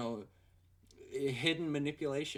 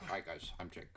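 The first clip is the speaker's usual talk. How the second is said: lower, softer dopey friend voice; mocking Jake; 6 semitones lower, 6 dB quieter, faster than usual. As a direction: parody your friend lower and duller. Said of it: "Jake voice".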